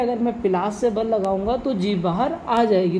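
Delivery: usual speaking voice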